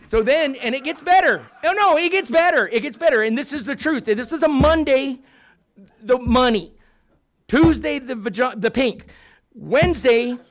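loud, high and rising hype; excited build. Real, not acted. Telling the room the story gets better, loud and hyped. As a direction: whip the crowd up, it gets better.

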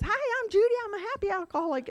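very high, loud, chirpy mock greeting; perky happy greeter. Judy answering the club phone, over-the-top happy. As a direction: burst out fast and piercingly cheerful. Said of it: "She goes".

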